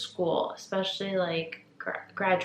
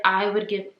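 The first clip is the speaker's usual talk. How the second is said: ordinary delivery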